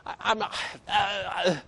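mock unsure mumble trailing off into nothing; a mock-timid soul winner losing his nerve mid-sentence; hesitant soul winner. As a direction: imitate a timid doubter fading to nothing. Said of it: "You ever seen someone like that".

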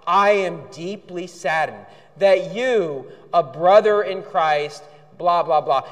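slow, drawn-out mock-pious read; over the top. Pastor parodies the expelled members' letters, slow and syrupy. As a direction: draw it out like fake piety. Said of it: "The same sort of over the top".

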